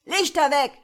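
shouted command in the dark. A narrator's reading. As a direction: yell to kill the lights. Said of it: "geschrien wurde".

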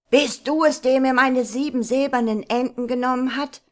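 laut und hoch gerufen, Troll ruft dem Burschen nach; wütender Ruf. Acted. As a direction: ruf es zornig übers Wasser hinaus. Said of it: "rief der Troll". (der Troll ruft dem fliehenden Burschen wütend nach)

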